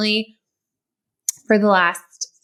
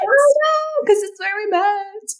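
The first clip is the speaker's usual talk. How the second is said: excited; 11 semitones higher, slower than usual. Real, not acted. Excitedly agreeing about shirt.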